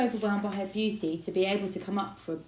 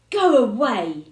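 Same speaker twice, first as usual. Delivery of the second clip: loud, sulky yell; shout; 4 semitones higher, 12 dB louder, slower than usual. A narrator's reading. Son yelling at dad through the door. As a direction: push him away with your voice. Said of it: "Joe shouted".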